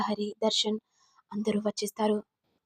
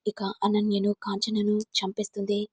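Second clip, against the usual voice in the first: usual speaking voice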